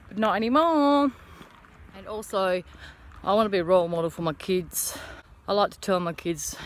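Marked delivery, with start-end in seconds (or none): 0.1-1.1 higher and louder, proud; triumphant. Real, not acted. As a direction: announce it proudly. Triumphant after self-deprecation.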